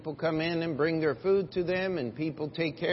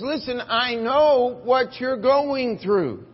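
loud, high, slow reassurance; slow forceful preaching; 7 semitones higher, 7 dB louder, slower than usual. Acted. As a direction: declare it to the church. Quoting Jesus with force.

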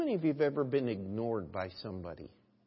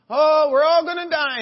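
high, loud hammed-up cry of doom; mock panic; 16 dB louder than usual. Pastor retelling a western movie, hammed-up panicked wail. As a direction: wail like a terrified settler in an old western. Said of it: "everybody was going".

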